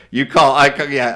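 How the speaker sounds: normal talking voice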